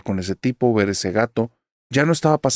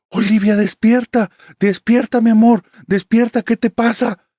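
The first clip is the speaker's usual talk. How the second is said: much higher and louder, urgent pleading; panicked waking call; 11 semitones higher, 5 dB louder than usual. Retold or imitated. Husband panicking as he tries to wake his sleepwalking wife. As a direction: shake her awake in alarm.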